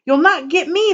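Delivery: high piggy cry; cried. Acted. Pig defying the wolf from inside, crying out. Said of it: "the piggy cried".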